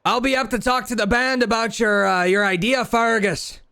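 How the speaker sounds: high-pitched holler from behind; last holler. Acted. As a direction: call after someone walking off. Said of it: "you hear from behind you one last holler".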